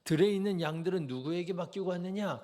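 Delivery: mock-scold the little brother; angry rebuke. Preacher voicing Eliab scolding David, mildly angry. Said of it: "인신공격적으로 화를 냅니다".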